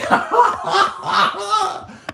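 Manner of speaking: high-pitched laughing fit; high burst of laughter. Real, not acted. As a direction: let out a high, loud laugh. Big laugh after name-calling.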